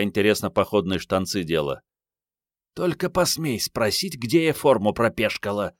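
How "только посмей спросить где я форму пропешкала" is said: high-voiced girl's threat, not whispered; warning hiss. A narrator's reading. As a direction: warn him off in a high voice. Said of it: "догадливо прошипела девушка". (предостерегающе, высоким девичьим голосом)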